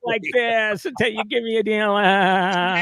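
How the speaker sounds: shrill comic TV star voice; Fran Drescher voice. Pretend. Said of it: "I'm gonna talk like this".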